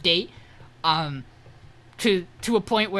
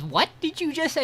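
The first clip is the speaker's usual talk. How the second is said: much higher, mock-scandalized question; retold stunned double-take; shocked disbelief; 9 semitones higher than usual. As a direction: pitch jumps up in acted outrage. Colette reacts to lewd remark.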